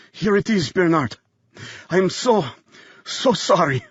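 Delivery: high, strained and faltering; halting, pained apology; dying apology. A narrator's reading. Dying man handing Bernard the paper, faltering.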